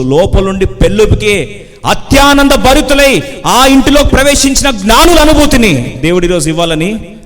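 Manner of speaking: high, loud, swelling proclamation; full-force preaching. Acted. Preacher promising the wise men's overflowing joy, full force. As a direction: let the joy surge into a ringing proclamation.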